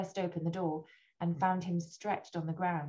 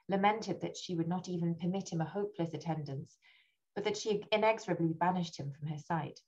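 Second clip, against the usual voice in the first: ordinary delivery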